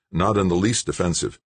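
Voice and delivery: plain reading voice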